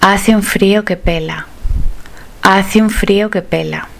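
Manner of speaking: ordinary talk, nothing marked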